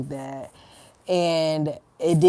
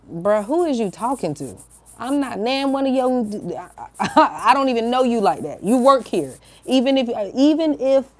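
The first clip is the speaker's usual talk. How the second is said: indignant imagined comeback, pitched up; confrontational old me; 3 semitones higher than usual. Retold or imitated. Her old self scolding the emergency line worker, indignant. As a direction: tell them off, rising into a higher voice. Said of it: "the old me was really about to pop up".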